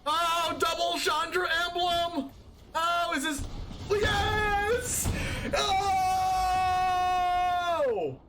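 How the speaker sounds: high-pitched squeal of delight; thrilled. Real, not acted. Streamer gets a double Chandra emblem, thrilled. Celebrating a lucky card.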